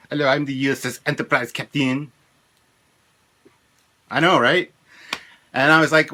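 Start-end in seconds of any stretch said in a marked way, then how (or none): 0.1-2.1 mock French captain in a French accent. Man imagining the new captain as a kid, mock French accent. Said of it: "with a French accent, you know".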